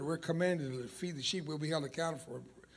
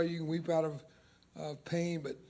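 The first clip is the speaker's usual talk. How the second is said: ordinary talk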